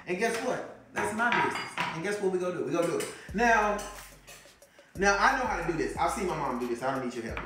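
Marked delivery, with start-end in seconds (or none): none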